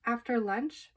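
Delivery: ordinary delivery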